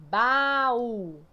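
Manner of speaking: "Baú" loudly stretched into two syllables; ba... Ú, drawn out with a strong ending; split, stress on U. Shown as a demonstration. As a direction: divide "Baú" slowly, holding the final U. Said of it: "Como é que eu divido baú?".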